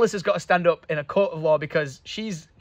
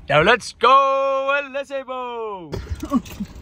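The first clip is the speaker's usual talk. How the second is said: sing-song call to car; 7 semitones higher, much slower than usual. Pretend. Addressing the car playfully.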